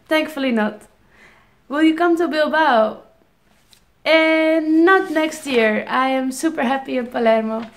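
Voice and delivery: usual speaking voice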